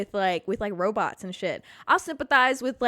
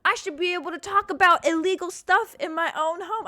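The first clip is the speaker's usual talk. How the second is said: indignant rant; 10 semitones higher than usual. Real, not acted. Indignant about being overheard.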